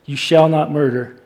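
ordinary delivery

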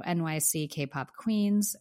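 ordinary talk

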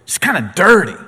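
high, loud, slowed preacher's build; fiery sermon climb; preaching at full force. Acted. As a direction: drive it home from the pulpit.

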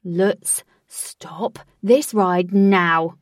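slow loud command. Acted. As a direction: demand it stop. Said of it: "commanded Wraith".

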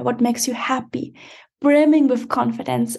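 normal talking voice

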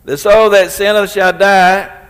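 repeated scripture thundered for emphasis. Shown as a demonstration. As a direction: hammer the verse home the second time. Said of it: "That's the sentence upon every".

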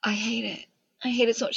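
soft, hushed disgust; quiet disgust. Real, not acted. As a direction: mutter your disgust softly. Disgust at plot reveal.

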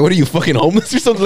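high, fast disbelieving question; shocked blurt. Retold or imitated. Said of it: "Literally like that".

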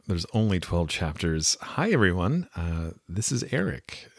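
ordinary delivery

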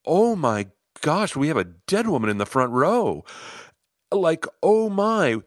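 stunned disbelief mid-scene; shocked gasp. Retold or imitated. Sees a seemingly dead woman.

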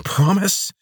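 mocking "Promise?" hissed up high and loud, rising; guard hissing his own word back at the prisoner. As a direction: throw his word back at him with scorn. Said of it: "she hissed".

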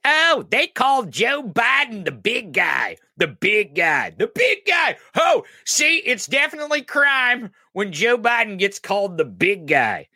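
mock them in a squealing outraged whine; high-pitched sarcastic mock outrage; mocking troll voice. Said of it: "They're like".

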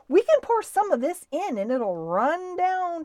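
put-on high, loud, eager boy; excited. George running back with a dish of applesauce, excited. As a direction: pitch the idea with breakneck enthusiasm.

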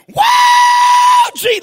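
very high, loud whooping cry; shouted wow. Retold or imitated. Preacher yelling on a plane, carried away, joyous yell. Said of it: "I'm like".

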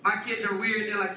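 ordinary talk

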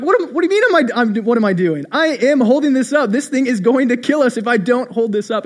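high, loud mock-frantic kid insisting; indignant panic, hammed up for a story; panicked protest. Frantic child answering laughing grandpa.

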